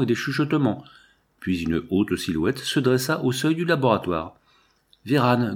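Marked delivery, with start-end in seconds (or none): none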